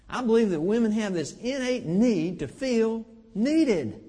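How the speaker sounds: ordinary delivery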